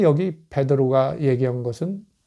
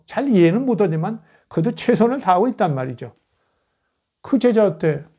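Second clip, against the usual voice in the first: ordinary talk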